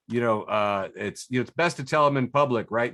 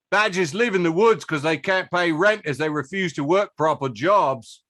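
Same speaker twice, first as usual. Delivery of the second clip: put-on Cockney accent; 4 semitones higher than usual. Reading badger facts to cohosts in a mild mock Cockney accent. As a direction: read it as a cheeky London Cockney. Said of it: "I'm gonna read it in a Cockney accent".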